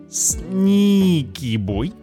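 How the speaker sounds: ordinary delivery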